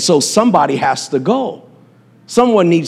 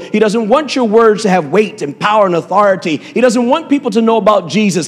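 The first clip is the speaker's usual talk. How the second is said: preaching; 5 semitones higher than usual. Acted. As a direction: drive it home like a pulpit preacher. Preaching builds to full force.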